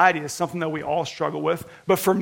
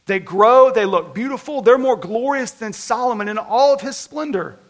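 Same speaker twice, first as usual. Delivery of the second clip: quoting scripture; 4 semitones higher, 4 dB louder than usual. Acted. Preacher quoting Jesus on lilies.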